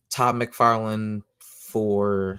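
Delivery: normal talking voice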